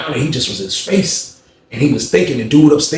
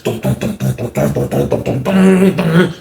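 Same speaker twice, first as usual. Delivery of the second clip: heavy vocalized stomps, rising at the end; thudding dums that build; imitated powerful footsteps; 4 semitones higher, slower than usual. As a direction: pound out the climbing steps. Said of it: "Rock went up the steps like this".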